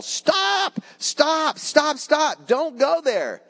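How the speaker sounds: high urgent shout of stop, repeated; warning yell. Retold or imitated. Warning a blind man walking to the cliff edge, high urgent yell. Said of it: "I bet every one of you would yell".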